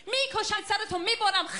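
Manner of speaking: mock-aggressive family talk in Farsi; passionate, seemingly aggressive Farsi. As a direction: sound fierce while meaning it fondly. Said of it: "as aggression".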